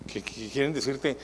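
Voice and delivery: ordinary talk, nothing marked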